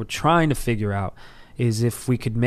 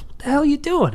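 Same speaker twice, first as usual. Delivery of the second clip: friend scolding; 12 semitones higher than usual. Retold or imitated. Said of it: "my buddy Matt goes".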